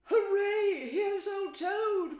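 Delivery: high, loud cheer of welcome; cheering cry. A narrator's reading. Mole welcoming Toad home, cheering loudly. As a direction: whoop it out in delight. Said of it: "cried the mole".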